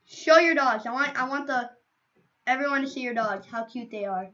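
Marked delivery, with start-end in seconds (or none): none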